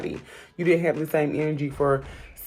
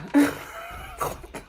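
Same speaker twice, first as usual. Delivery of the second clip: high breathy laugh; 12 semitones higher, 4 dB quieter than usual. Real, not acted. Laughs at comeback.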